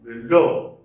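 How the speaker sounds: "Below" with a loud final syllable; brief "be", then lean hard on "LOW" in "Below"; teacher's end-stressed "Below"; stress on second syllable. Shown as a demonstration. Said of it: "Stress is on the second syllable".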